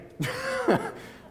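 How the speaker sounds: high-pitched chuckle at oneself; short, high, amused laugh; self-deprecating laugh. Real, not acted. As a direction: laugh off your own lack of talent. Laughs at own inability to rap.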